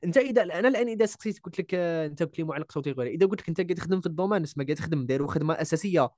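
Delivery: ordinary delivery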